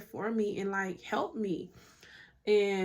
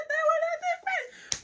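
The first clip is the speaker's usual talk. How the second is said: surprised squeak; much faster than usual. Real, not acted. Startled squeak at herself.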